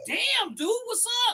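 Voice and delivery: high-pitched mock amazement; squeaky-high comic disbelief; retold astonishment. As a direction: act incredulous at your buddy. Retells astonished reply to the story.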